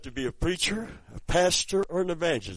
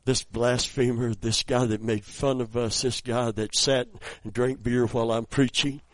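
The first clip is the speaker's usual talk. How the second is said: ordinary talk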